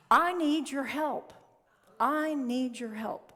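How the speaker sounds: loud, slow, high cry for help; emphatic plea. Acted. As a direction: plead in drawn-out words, acting the little bird. Little bird pleads with the eagle.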